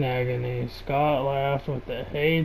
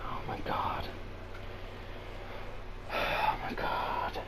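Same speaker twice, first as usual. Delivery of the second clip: whisper; 13 dB quieter, slower than usual. Real, not acted. Reacting in disgust to fanfic.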